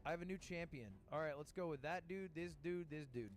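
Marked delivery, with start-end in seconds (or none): none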